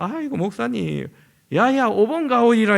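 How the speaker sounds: loud, high elder calling in Gyeongsang dialect; 경상도 사투리로 크게 부르는 권사님. Retold or imitated. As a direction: bark the order like a Gyeongsang grandma. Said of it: "경상도 말로".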